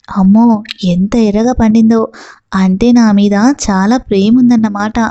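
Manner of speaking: ordinary delivery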